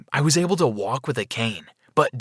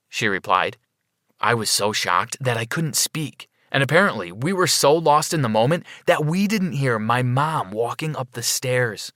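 usual speaking voice

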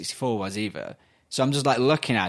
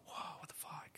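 whispered stunned amazement; awed whisper; 26 dB quieter than usual. Retold or imitated. Child heading back up to bed, awed whisper. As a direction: whisper it to yourself, blown away.

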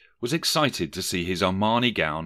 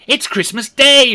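very high, loud, overjoyed; delighted cry; 12 dB louder than usual. A narrator's reading. Scrooge realising he hasn't missed Christmas, overjoyed cry. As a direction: squeal it with sheer relief.

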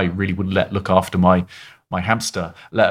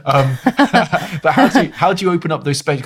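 laughing question, pitched higher; 7 semitones higher, 4 dB louder than usual. Real, not acted. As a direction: ask it through a real laugh. Laughing while asking her question.

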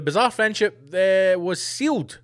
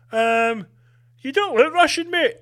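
mock border guard; 8 semitones higher, 3 dB louder than usual. Border guard voiced in bit.

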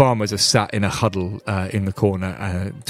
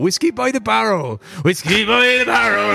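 building; 11 semitones higher, 4 dB louder than usual. Acted. GM barman building up.

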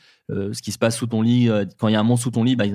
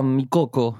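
put-on a light Spanish accent on mi Coco; playful hint of a Spanish accent; much slower than usual. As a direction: voice the film character in a quick Spanish accent. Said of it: "C'était mon accent espagnol".

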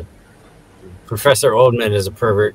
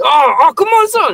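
very high, louder mock shout; mock high yell; 5 dB louder than usual. Shorty shoving David Cross, very high mock yell. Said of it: "And then he's".

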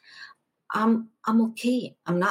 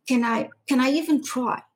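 higher, louder stammering plea; desperate plea; 6 semitones higher, 5 dB louder than usual. Retold or imitated. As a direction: beg for the chance to try. Pleading to try moving.

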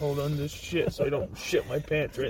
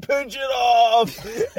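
mocking shout; 7 semitones higher, 5 dB louder, much slower than usual. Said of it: "somebody out there is just like".

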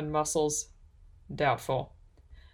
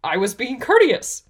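higher, louder puzzled protest; rather confused; 6 semitones higher, 7 dB louder than usual. A narrator's reading. Elf explaining why she helped the man, confused. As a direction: defend yourself in puzzlement. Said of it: "Risa said rather confusedly".

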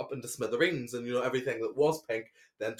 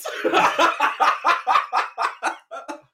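high giggle fit; 13 semitones higher, 8 dB louder than usual. Real, not acted. Cracking up at name.

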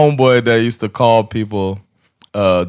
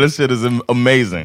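ordinary delivery

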